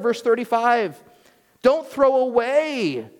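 high, slow, pleading sermon climax; impassioned preaching. Acted. Pastor urging his congregation to hold fast, impassioned preaching. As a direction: lean in and urge them not to give up.

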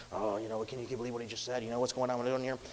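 low, hushed gossip among onlookers; imitated crowd murmur. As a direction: grumble it quickly under your breath. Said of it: "if there's some murmuring going on".

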